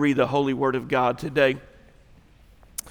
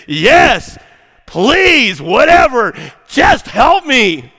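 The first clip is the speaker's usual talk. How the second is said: high, loud, exasperated begging; frustrated yell; 8 semitones higher, 8 dB louder, much slower than usual. Acted. Hanging man tired of questions yells upward, frustrated yell. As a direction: holler it, fed up with all the talking. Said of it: "the man's frustrated and he's tired of all the talking".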